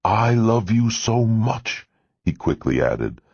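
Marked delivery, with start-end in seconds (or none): 0.0-1.9 slow, high, tearful declaration; a moved father close to tears on the phone. A narrator's reading. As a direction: let the tears creep in as you say it. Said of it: "sounded like he was crying".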